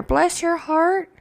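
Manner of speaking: drawn-out sweet condescension; pitying southern reply. Retold or imitated. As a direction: pity them sweetly and slowly.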